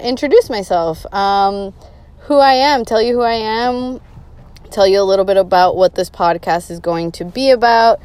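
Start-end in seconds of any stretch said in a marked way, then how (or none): none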